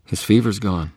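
plain reading voice

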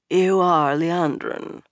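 slow, slurred noblewoman voice; slurred speech. A narrator's reading. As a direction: lazily slur the words. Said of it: "Her speech was slurred".

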